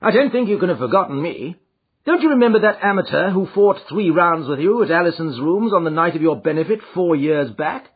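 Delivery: lightly voiced, amused detective; a confident gentleman, teasing; Sherlock Holmes voice.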